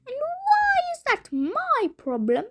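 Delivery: high shouting witch voice. A narrator's reading. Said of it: "shouted the witch".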